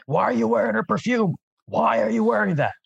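high-pitched accusing cry; acted yell. Player yells at the person who bandaged their hand, acted yell. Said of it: "I'm gonna yell out at that".

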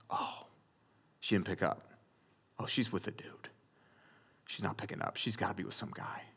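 near-whispered suspicious muttering, low and slipping away; brooding self-talk. Retold or imitated. Boyfriend imagining she's with some guy, muttering darkly. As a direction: barely voice it, stewing to yourself.

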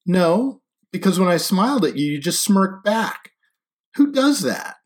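high, loud accusation; indignant. A narrator's reading. She scolds him for smirking back at her, indignant. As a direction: throw the complaint at him, pitch climbing.

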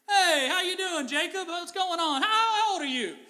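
high, loud, eager greeting; a king delighted to meet a guest; excited Pharaoh. Acted.

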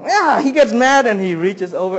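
ordinary talk, nothing marked

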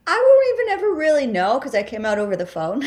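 half-laughing, amused at the memory. Real, not acted. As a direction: laugh through the confession. Laughs recounting coming out.

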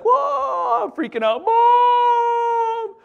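high, loud panicked cry for mom; mock-panicked child calling mom; panicked yell. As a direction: shriek for your mother like a hurt kid. Cut finger, panicking kid.

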